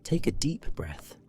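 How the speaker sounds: usual speaking voice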